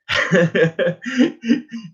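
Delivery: amused run of chuckles; laugh. Real, not acted. Storyteller amused by his old self-doubt, real chuckling.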